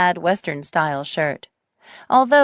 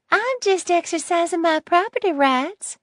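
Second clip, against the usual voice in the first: put-on sweet old lady voice; 12 semitones higher than usual. Nettie voiced in story.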